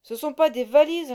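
loud gruff soldier voice; loud sentry. A narrator's reading. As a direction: throw it at her like a rough guard. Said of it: "lança la sentinelle".

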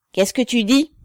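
high, loud, rough demand; rude shout. A narrator's reading. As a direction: snap at her like a harsh officer. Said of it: "cria l'officier avec rudesse".

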